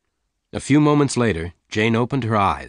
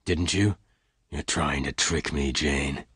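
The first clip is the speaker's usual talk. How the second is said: hard voice; 5 semitones lower, 6 dB quieter, slower than usual. A narrator's reading. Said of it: "said in a hard voice".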